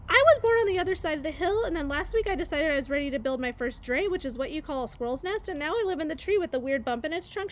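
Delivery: high, fast, eager babble; chattering squirrel. Acted. Chit Chat tells Bright Bill her life story, fast high chatter. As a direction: race through it like an excited young squirrel.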